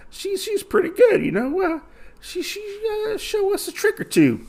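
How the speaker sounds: squeaky falsetto mockery of a swordswoman; mocking thugs. Thugs mocking the swordswoman behind her back, squeaky falsetto. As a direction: jeer in a high put-on girly voice. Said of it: "these guys, you know, talking".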